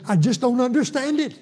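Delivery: high-pitched, mildly exasperated complaint; retold frustration. Patient complains to preacher about the Bible, retold frustration. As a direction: voice his bafflement, pitched well up.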